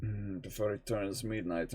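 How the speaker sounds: half-whispered mulling over the time; soft thinking-aloud repeat; quiet musing. Real, not acted.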